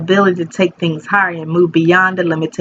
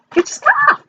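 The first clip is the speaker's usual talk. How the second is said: much higher squeaky mock call. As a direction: pipe it up high like a nagging call.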